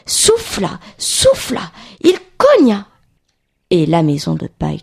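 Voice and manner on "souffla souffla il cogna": high, puffed storytelling, building to a loud blow; acted-out huffing. A narrator's reading. Narrator shows the wolf blowing and banging, emphatic. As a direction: huff each verb, then hit the last.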